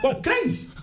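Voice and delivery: a dad catching a flash of blue, laughing; surprised. Retold or imitated. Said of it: "and I was like".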